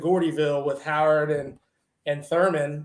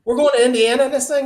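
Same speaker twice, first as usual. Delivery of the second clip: disbelief; 8 semitones higher, 7 dB louder, faster than usual. Retold or imitated. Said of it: "So I'm like".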